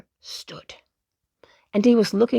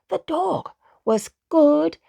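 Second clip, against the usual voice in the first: ordinary delivery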